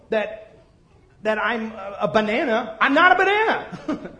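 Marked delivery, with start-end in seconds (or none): 2.8-4.2 laughing high and loud at the joke; laughing it off to the congregation. Real, not acted. Preacher laughing at his own banana joke.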